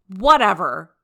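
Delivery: loud dismissive outburst; exclaimed. Acted. Captain brushing off the criticism, loud exclamation. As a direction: wave it off loudly. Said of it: "Jiraiya exclaimed".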